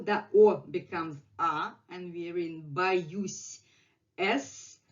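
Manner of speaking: ordinary talk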